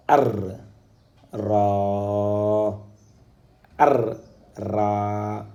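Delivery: "ar, ra" split to sound the r twice; doubled letter. Shown as a demonstration. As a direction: break it into "ar", then a long "ra". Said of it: "la lettre elle est doublée".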